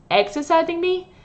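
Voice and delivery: incredulous high question; disbelieving echo of the doctor; surprised. Acted. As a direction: caught off guard, voice jumps up. Patient shocked at doctor's claim.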